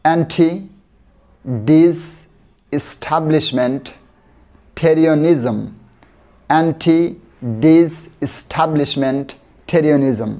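stretched far out, sound by sound; very slow. Shown as a demonstration. Teacher drilling a word for learners, very slow. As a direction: crawl through the word for learners. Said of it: "আস্তে আস্তে শিখে চলুন".

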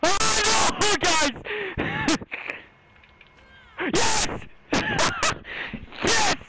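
high, loud triumphant yelling; excited screaming. Real, not acted. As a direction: scream with relief and joy. Frantic then triumphant.

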